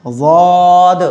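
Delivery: "ज़ाद" heavy, with a long held vowel; pronunciation demo. Shown as a demonstration. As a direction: stretch "ज़ाद" out on a level tone. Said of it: "ये ज़ाद है". ("ज़ाद" को खींचकर मोटा पढ़ें)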